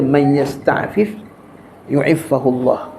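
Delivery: Arabic hadith, drawn out very slowly; slow reverent recitation of the hadith in Arabic. Acted. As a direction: recite the saying with reverence, word by word. Quoting the Prophet's Arabic saying.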